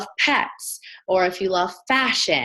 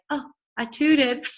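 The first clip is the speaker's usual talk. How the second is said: imitating a toddler, slow and higher; 6 semitones higher, slower than usual. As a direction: say it like a little girl confessing. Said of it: "then she's like".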